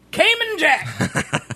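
slow, savoring mock delivery; drawn-out mock announce. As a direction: stretch the name out slowly. Mocking drink name drawn out.

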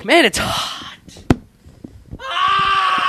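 shouting. Real, not acted. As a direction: yell it out in the heat. Complaining loudly about the heat.

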